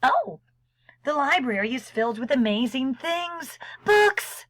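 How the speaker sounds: high, quick burst of delight; thrilled storybook exclamation; excited wonder. Acted.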